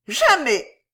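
high, loud indignant lady; vehement refusal. A narrator's reading. (jamais aigu et indigné)

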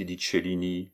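usual speaking voice

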